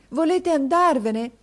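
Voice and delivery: put-on boy repeats his plea to his teacher, slowly. A narrator's reading. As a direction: draw out the repeat, begging.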